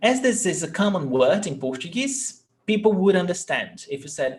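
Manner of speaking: ordinary talk, nothing marked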